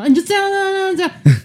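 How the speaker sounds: mock impatient coach, loud and high. Retold coach waving from afar, loudly impatient. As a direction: boyfriend barking vague directions from five steps away. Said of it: "就用那边比手画脚说".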